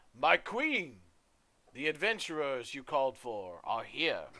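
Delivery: a loud herald's call to the queen; deep voice. Acted. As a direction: announce them across the hall. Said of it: "calls out in a deep voice".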